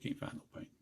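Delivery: whispered report. Retold or imitated. A boy's bad news retold in a whisper. Boy reports he found no paint.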